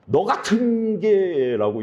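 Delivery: slow, high, contemptuous sneer; strong scornful intonation. Acted. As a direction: spit the insult out with heavy intonation. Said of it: "억양을 좀 세게 막 하면서". (억양을 세게 넣은 경멸조)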